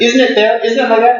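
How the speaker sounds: put-on high, loud, insistent old teacher; the old abbot pointing at the Dalai Lama, high and loud; fierce old abbot. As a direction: press him hard like a hot-tempered master. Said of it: "very direct".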